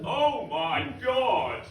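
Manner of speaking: high, drawn-out far-off cry; high distant scream. Retold or imitated. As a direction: cry out from far away in the woods. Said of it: "that's all me".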